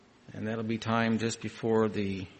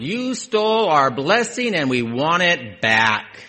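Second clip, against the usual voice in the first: accusing nations; 4 semitones higher, 8 dB louder than usual. Retold or imitated. Voicing nations' grievance.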